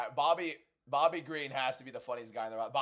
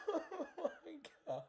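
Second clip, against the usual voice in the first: squeaky laugh, fading soft; laughing too hard to speak; high breathless giggle; high wheezing laugh; 14 semitones higher, 8 dB quieter, slower than usual. Real, not acted.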